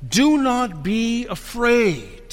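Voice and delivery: slow, measured proclamation; angel's proclamation. Acted. Angel to frightened women at the tomb, slow proclamation. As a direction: declare it slowly, each word weighted. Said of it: "he said to them".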